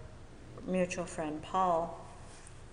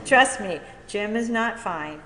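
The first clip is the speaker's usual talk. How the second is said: loud, raised, retold insistence; emphatic insistence; 5 semitones higher, 10 dB louder than usual. Telling a friend her sponsor needs them, insistent. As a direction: imitate firmly overruling a friend.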